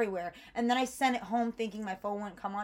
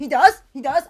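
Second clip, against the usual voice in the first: loud, high, rising gasp of hope; excited disbelief; 6 semitones higher, 17 dB louder than usual. Retold or imitated. Hearing her lost bag was found, a loud excited rise. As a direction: burst out in hopeful disbelief.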